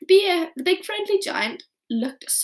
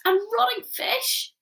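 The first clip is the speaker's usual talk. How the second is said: gasping; 11 semitones higher, slower than usual. A narrator's reading. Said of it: "she gasped".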